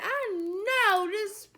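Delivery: slow, high, drawn-out deadpan; very slow, high, dry sarcasm; sarcastic. Retold or imitated. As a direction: drag it out, unimpressed. Sarcastic aside to date.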